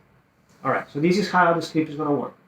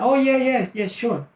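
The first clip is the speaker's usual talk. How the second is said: much higher, drawn "Oh, yeah"; ready agreement; 8 semitones higher, slower than usual. Retold or imitated. Coach imitating the seller's answer, ready agreement.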